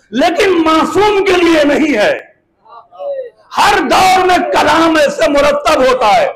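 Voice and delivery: ringing pulpit oratory with sustained pitch; impassioned preaching. Acted. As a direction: declaim forcefully to the assembly. Preacher declaims at full force.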